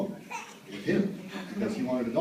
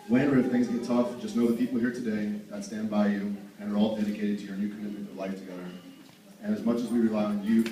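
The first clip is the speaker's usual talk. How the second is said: raised formal toast voice; wedding toast speech; 5 dB louder than usual. Real, not acted. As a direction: lift it up to the room. Closing toast to the couple.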